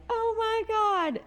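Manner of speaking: high, drawn-out astonished squeal; slow high-pitched gasp of amazement; stunned delight. Retold or imitated. Her reaction to the proposal retold.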